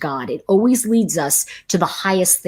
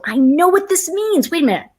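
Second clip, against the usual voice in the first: frustrated realization; 9 semitones higher, 3 dB louder than usual. Retold or imitated. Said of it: "And you go".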